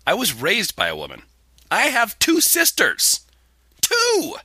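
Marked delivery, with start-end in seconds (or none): 1.7-4.3 mock indignation. Host defends himself against hate mail, mock indignant.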